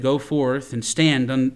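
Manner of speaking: ordinary delivery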